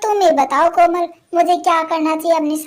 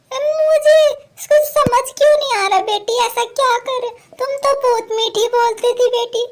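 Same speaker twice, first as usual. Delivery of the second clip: put-on raised, plaintive old woman's voice; a confused old mother asking why she is scolded; hurt old woman; 8 semitones higher than usual. Mother-in-law asks why her daughter-in-law scolds her, plaintive old voice.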